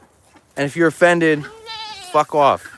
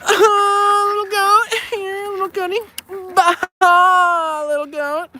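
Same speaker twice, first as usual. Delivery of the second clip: put-on high, slow baby-talk coo; high, slow cooing to a goat; much slower than usual. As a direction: coax the little goat sweetly. Cooing to goats.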